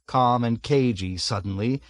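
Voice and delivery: plain reading voice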